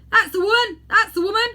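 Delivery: high, loud accusing shout; crying out. Acted. Fat man shouting at the crowd about the woman, loud and accusing. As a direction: yell it, pointing her out. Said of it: "cried the fat man".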